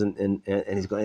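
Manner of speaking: ordinary talk, nothing marked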